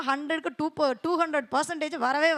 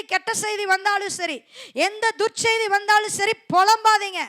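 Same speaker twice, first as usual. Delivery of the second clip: louder exhorting sermon; preaching; 5 semitones higher, 6 dB louder than usual. Acted. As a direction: urge the church firmly. (உரத்த அறிவுரை பிரசங்கம்)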